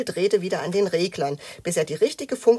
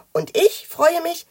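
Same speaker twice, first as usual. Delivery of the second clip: high, loud, slow motherly delight; pleased mother; 11 semitones higher, 9 dB louder, slower than usual. A narrator's reading. Mother joins father's approval of the children, pleased. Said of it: "sagte ihre Mutter".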